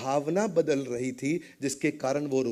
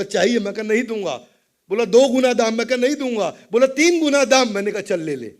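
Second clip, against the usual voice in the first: usual speaking voice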